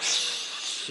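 unvoiced hush; hushing shh. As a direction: shush him quietly. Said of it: "hushing Chad at 4:30 gym".

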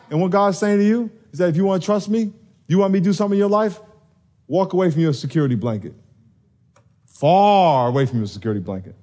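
usual speaking voice

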